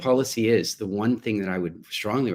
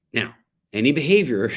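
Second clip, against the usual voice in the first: ordinary talk, nothing marked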